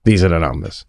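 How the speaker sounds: low, soft, deadpan readout; just read the numbers, no fuss; flat lottery lady. Pretend. What gamblers want the lottery lady to say, deadpan. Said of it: "lady to come on and go".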